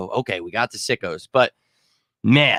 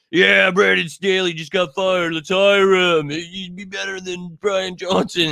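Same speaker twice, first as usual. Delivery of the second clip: mocking a fan; 6 semitones higher, slower than usual. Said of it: "Wanna hire all these losing coaches?".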